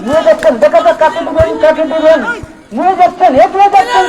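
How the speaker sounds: excited commentary. Real, not acted. Live commentary as attack builds.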